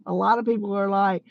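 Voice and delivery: usual speaking voice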